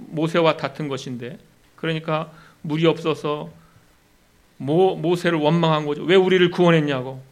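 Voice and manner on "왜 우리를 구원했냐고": loud accusing why; a grumbling people confronting Moses; mock complaint. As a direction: demand it of Moses, louder. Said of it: "원망하고 따진 것이죠".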